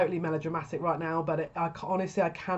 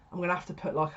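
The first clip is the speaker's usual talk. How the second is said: normal talking voice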